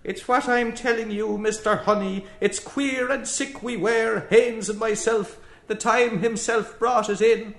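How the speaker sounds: keen it in a querulous Irish brogue. Acted. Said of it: "keened in querulous brogue".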